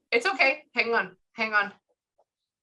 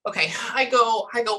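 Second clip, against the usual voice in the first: normal talking voice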